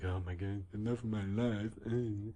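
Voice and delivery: hammed-up gushing girlfriend; imitated swooning happiness; mock lovestruck. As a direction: gush in mock delight at seeing a boyfriend. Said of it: "so happy to see him".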